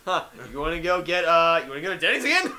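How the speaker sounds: hammed-up pal invitation; mock friend. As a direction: rattle it off like the eager friend. Voicing Dan's question.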